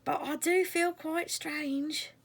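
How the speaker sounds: high, slow, drowsy voice; a dozy creature, slowly; sleepy caterpillar. Acted.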